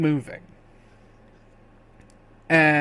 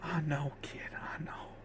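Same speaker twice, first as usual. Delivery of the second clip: barely voiced, fading away; dying voice; 17 dB quieter than usual. Dying man comforts the kid, barely audible. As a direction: comfort them with the last of your strength. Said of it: "as the strength fades from him".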